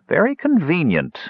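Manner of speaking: high-pitched snide jab. A narrator's reading. Accusing elf doubts the dwarf's excuse, snide. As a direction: drop the accusation with a sneer, pitch up. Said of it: "the accusing elf said snidely".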